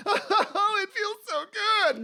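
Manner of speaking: very high, mock pained laughing; laughing through pain. Client being massaged, high re-enacted laughing through pain. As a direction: squeal it, laughing through the hurt. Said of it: "laughing through the pain".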